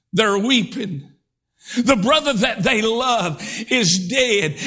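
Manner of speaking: ordinary talk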